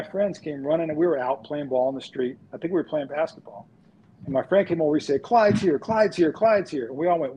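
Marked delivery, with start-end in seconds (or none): 5.2-6.9 higher, louder, urgent repeats; breathless-news delivery, pitched up; excited kid announcing. Retold or imitated. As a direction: imitate an excited friend spreading big news. Excited friend announcing a star sighting.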